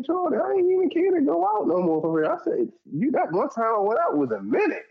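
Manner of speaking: high, exasperated protest; exasperated pushback. Retold or imitated. Man arguing with Jordan about going out, high exasperated. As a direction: push back, fed up. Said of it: "I was like".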